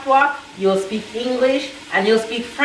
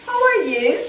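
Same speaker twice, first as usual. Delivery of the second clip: English greeting; 6 semitones higher than usual. Shown as a demonstration. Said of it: "when you see English people ... you say".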